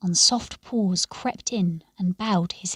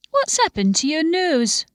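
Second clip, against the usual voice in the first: innkeeper's question; 7 semitones higher, 3 dB louder than usual. Acted. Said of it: "he said".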